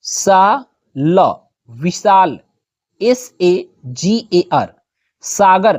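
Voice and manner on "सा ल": syllable by syllable. Shown as a demonstration.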